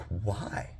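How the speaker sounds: soft, timid imitation of a child; small child's question. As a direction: ask it quietly, as a worried little boy. Teary son asks father.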